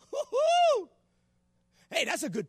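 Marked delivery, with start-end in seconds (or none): 0.1-0.9 high-pitched "woo-hoo"; high whoop. Real, not acted. Preacher reacting to Peter's short prayer, high whoop.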